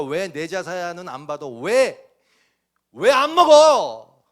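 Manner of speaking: loud mock outrage of Cain; Cain's anger. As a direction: rage like a rejected brother. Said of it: "이러면서 분노하고".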